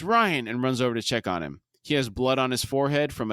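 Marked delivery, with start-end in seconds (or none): 0.0-0.5 high retold yell of the name; raised, urgent cry. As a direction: call out in alarm, pitched well up. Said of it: "Homelander yells".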